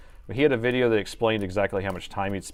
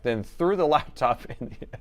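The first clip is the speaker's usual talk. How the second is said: ordinary talk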